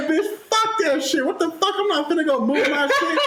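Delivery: high-pitched, indignant retold tirade; heated mock rant with laughter creeping in; cussing her out. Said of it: "I was just cussing this girl out".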